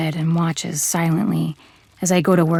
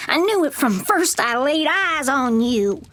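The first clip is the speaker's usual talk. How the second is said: higher, bitter snarl; 7 semitones higher than usual. Acted. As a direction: spit it like a cornered animal. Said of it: "she snarls".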